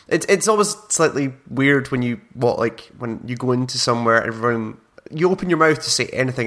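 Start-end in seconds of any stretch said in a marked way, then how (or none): none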